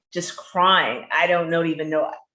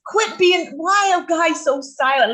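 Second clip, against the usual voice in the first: high, loud exasperated plea; mock exasperation; 13 semitones higher, 6 dB louder than usual. Real, not acted. Mock scolding silent men.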